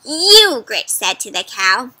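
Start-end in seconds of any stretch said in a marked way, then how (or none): 0.1-0.6 higher, loud accusing witch; sharp witch call. Acted. Witch singling out the cow, loud. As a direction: jab it at the cow.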